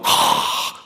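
mock exasperated huff; acted voiceless sigh; exasperated kid's sigh. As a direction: breathe out like a fed-up kid. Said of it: "Don't make your kids go".

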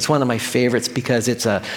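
normal talking voice

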